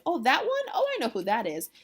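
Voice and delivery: high, loud mock "oh"; eager recognition. Nurse to Juliet, wrongly sure who he is, high mock recognition. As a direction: imitate sudden confident recognition.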